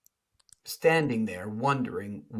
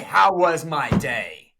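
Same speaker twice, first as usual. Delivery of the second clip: indignant retort; 3 semitones higher, 8 dB louder, slower than usual. Retold or imitated. Angry retort to cheery greeting.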